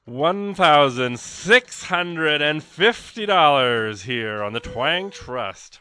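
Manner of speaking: loud, slow, drawn-out reveal; big announcement. Real, not acted. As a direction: announce it like after a drum roll. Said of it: "Get ready for a drum roll".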